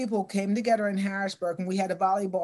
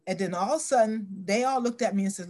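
normal talking voice

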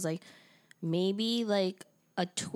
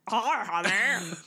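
slow alien farmer voice; drawn-out creepy bug-man greeting; bug guy voice; much slower than usual. Retold or imitated. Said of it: "'cause that's how he talks".

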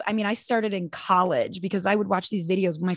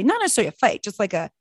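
normal talking voice

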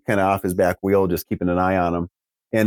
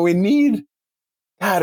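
ordinary talk

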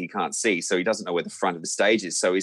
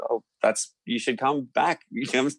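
giggly invitation; 7 semitones higher than usual. Retold or imitated. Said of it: "We said".

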